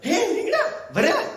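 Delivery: high, excited squeal over dinner; delighted son. Acted. Son hears mother cooked brinjal, thrilled.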